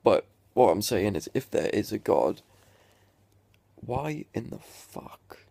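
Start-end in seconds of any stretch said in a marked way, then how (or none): 3.8-5.5 whispered in disbelief, slow; incredulous whisper. Real, not acted. Questioning God mid-rant, slow disbelieving whisper. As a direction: breathe it out, baffled.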